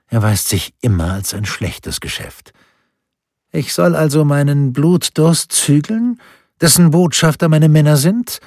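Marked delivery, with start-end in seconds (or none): 3.5-8.4 higher, louder questioning with a touch of disappointment; Spur von Enttäuschung. A narrator's reading. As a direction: let a little disappointment into the duke's raised voice. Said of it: "mit einer Spur Enttäuschung in der Stimme". (mit einer Spur Enttäuschung, höher und lauter)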